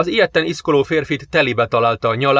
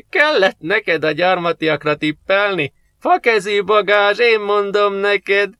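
higher, slow teasing mechanic voice; a slow, higher, gloating mechanic laughing at his friend; mocking mechanic; 8 semitones higher, slower than usual. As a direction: rib him like a jeering workmate. Jani teasing Máté, voiced.

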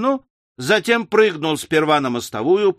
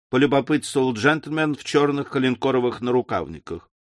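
ordinary delivery

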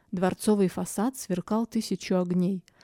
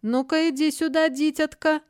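high, loud, mocking and languid; lazy mocking king; 8 semitones higher, 4 dB louder than usual. A narrator's reading. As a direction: tease her like an indulgent king. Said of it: "продолжал король вальяжно и насмешливо".

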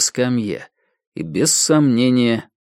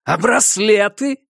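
high, slow angry demand; furious prisoner calling back; angry shout; 10 semitones higher, slower than usual. A narrator's reading. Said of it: "голос разъярённого Сэма".

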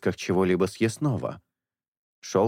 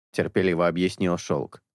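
usual speaking voice

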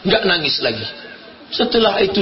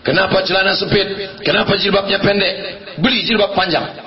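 ordinary talk

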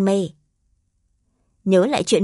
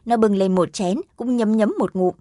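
plain reading voice

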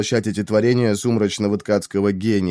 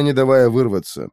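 ordinary delivery, nothing marked

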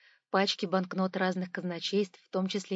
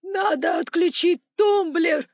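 high, loud panicked cry; desperate high-pitched shout; sudden scream; 10 semitones higher, 11 dB louder, slower than usual. A narrator's reading. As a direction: blurt it out at full volume. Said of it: "неожиданно закричал управляющий".

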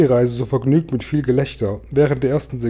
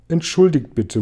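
ordinary delivery

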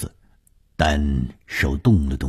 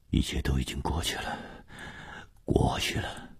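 murmured reassurance; 2 semitones lower, 11 dB quieter than usual. A narrator's reading. 红鱼 comforting the rescued 舒子银, murmured. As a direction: murmur it gently, almost under the breath. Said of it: "红鱼喃喃地说".

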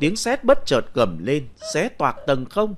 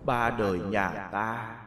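distant ghostly voice; 7 dB quieter than usual. Acted. Dead husband's ghost calms his widow, soft and far away. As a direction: speak like an echo from beyond. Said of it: "thanh âm thoát tục vọng về".

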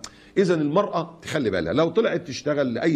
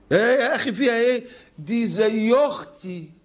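high, slow drawn-out defence; mock casual excuse; 9 semitones higher, slower than usual. Preacher mocking a man who calls his coworker a sister, high drawl. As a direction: drawl it out like it's nothing.

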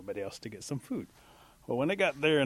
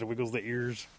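usual speaking voice

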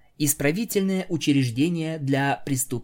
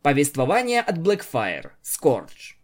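usual speaking voice

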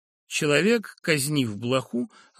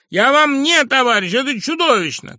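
much higher and louder outraged cry; indignant shout; 12 semitones higher, 11 dB louder than usual. Acted. Professor refuses to be called comrade, shouting. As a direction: hurl it back, offended. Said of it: "раздражённо воскликнул".

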